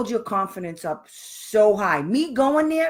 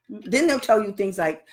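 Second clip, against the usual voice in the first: ordinary talk, nothing marked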